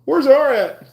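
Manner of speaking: high mock announcer calling out; mock store page. Imitating a store worker looking for him, high mock call. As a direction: pitch it up like a store clerk paging. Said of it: "I guess that stands for [name]".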